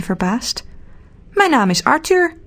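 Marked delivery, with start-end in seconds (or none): 1.3-2.4 little man Arthur. A narrator's reading. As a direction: introduce yourself as a small cheery man. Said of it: "zegt het mannetje".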